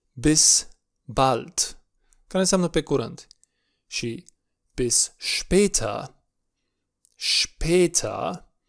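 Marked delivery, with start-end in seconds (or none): 4.7-8.5 später with ä said as e, slowly; German später. Shown as a demonstration. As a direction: say später as shpeh-ta, drawn out. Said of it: "acel A cu două puncte se pronunță E". (später cu ä pronunțat e, rar)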